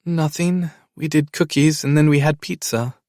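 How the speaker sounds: put-on chatting child; little girl. A narrator's reading. Child reporting her day.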